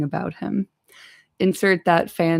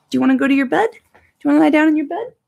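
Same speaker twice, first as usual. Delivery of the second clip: coaxing the dog; 9 semitones higher, 7 dB louder, much faster than usual. Real, not acted.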